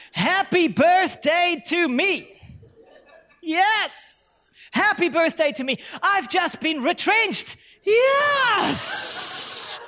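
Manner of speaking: high, bright, overjoyed caller; mock excited phone call. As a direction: play a gushing friend with great news. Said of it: "you phone a friend".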